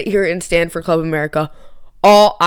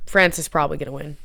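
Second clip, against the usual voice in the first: ordinary talk, nothing marked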